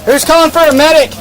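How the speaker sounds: loud callout. Real, not acted. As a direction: call it out across the field. Calling out to team.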